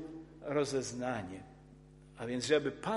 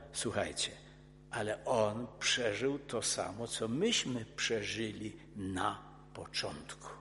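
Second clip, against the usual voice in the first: calm reply; 8 semitones lower, 7 dB quieter than usual. Retold or imitated. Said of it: "A Piotr powiada".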